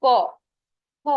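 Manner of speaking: ক and খ said alone with a pause; teacher repeating ক খ as a demonstration; consonant demo. Shown as a demonstration. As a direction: sound out ক then খ from the throat. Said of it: "তুমি উচ্চারণ করো".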